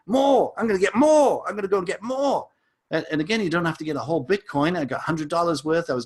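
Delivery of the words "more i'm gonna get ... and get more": mock excited.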